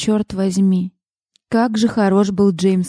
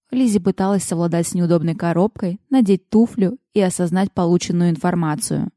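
usual speaking voice

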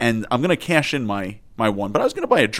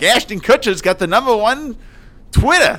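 Regis impression; 11 semitones higher, 4 dB louder than usual. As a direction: do Regis like a gossipy yenta. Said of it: "I love your Regis impersonation".

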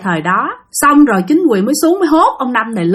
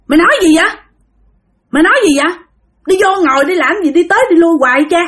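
calling out; 6 semitones higher, much faster than usual. Retold or imitated. Drinking buddies shout impatiently at Năm. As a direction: hurry him to sit down.

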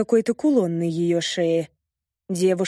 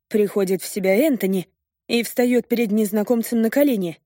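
usual speaking voice

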